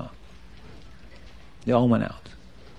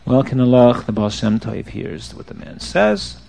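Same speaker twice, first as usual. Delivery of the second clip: usual speaking voice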